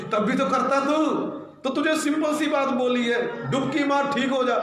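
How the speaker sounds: high, pressing rebuke; scolding servant. Acted. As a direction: push him to just do it.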